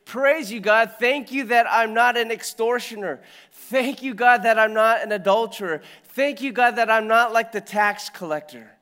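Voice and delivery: hammed-up pompous thanks to God; self-righteous Pharisee. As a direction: puff up like a proud Pharisee. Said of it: "the Pharisee ... praising God and he says".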